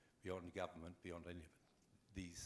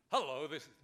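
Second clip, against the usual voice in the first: big, loud announcer drive on hello; mock announcer greeting, loud; radio announcer voice; 7 semitones higher, 12 dB louder than usual. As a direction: boom it out like an old radio announcer. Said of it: "sound like a radio announcer".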